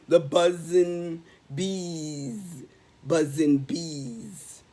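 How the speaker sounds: drawn out, very slow buzzing bees. Acted. Reading to kids, dreamy slow bee buzz. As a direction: stretch it like lazy bees.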